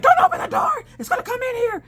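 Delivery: very high, loud hammed-up shriek; mock-terrified high yell; retold panic. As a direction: beg him frantically, squealing. Acted panic at the back door.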